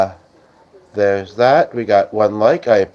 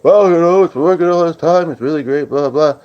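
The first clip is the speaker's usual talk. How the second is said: mock quote; 6 semitones higher than usual. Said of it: "He's like".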